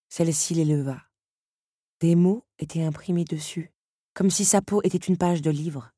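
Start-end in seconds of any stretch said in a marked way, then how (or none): none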